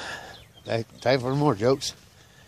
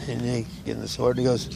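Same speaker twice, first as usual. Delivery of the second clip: usual speaking voice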